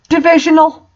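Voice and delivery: a high, loud, baffled repeat of the misspelt word; incredulous. Real, not acted. Reading a misspelt word from a bad fanfic aloud, incredulous. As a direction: disbelief at a silly misspelling.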